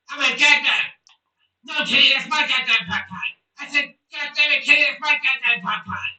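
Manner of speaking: loud, whiny demand rising to a yell; whiny bratty cartoon kid. Hungry kid yelling at Mom and cat, bratty impression voice. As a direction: yell it like a spoiled cartoon brat.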